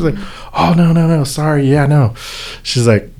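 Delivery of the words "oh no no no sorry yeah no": higher-pitched, repeated brush-off; retold polite refusal; imitated flustered apology. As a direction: wave away help apologetically. Mom apologetically declining help.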